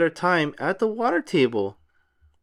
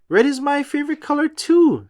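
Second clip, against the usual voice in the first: kangaroo voice; 11 semitones higher, 4 dB louder than usual. A narrator's reading.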